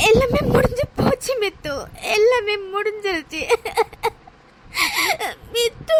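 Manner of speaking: sobbing out the words; heartbroken, crying it all out; tearful, despairing; weeping. Acted. Heartbroken woman weeps.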